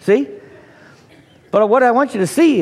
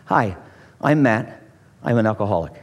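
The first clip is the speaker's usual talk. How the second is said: AA meeting intro; 9 semitones lower than usual. Retold or imitated. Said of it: "It's always gonna be the same".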